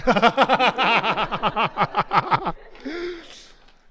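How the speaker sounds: long, loud burst of laughter; high, helpless run of laughter; big laugh. Real, not acted. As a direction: crack up loudly and keep going. Game master cracks up at request.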